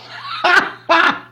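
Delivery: high-pitched burst of laughter. Real, not acted. YouTuber laughing at his Microsoft joke, high laugh.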